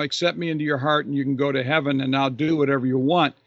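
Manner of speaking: fast, run-together mock invitation; rattled-off mock quote. Preacher mimicking what Jesus didn't say, rattled off fast. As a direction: rattle it off like a glib formula.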